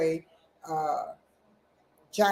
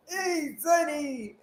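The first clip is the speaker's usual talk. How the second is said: put-on high, slow creaky old man; 8 semitones higher, slower than usual. Shown as a demonstration. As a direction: croak it like a frail grandpa. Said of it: "I can't even do my old man voice no more".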